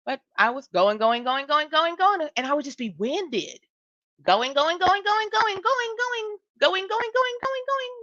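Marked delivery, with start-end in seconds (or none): 0.7-2.3 relentless build. Retold or imitated. Speaker retelling how they kept going, relentlessly building. Acting out relentless non-stop pushing. 4.2-7.9 climbing to a very high, strained repeat; relentless, winded. Retold or imitated. Speaker showing how they never settled down, frantically relentless.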